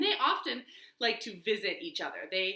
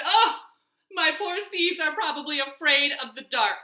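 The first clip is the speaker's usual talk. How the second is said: loud, raised-pitch lament; dismayed fretting; 6 semitones higher, 8 dB louder than usual. A narrator's reading. Toad worrying about seeds at night, dismayed fretting. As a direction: wail with mild hammed-up worry.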